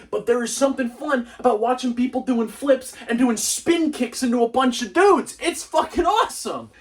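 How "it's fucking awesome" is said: normal talking voice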